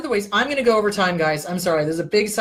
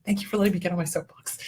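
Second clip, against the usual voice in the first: usual speaking voice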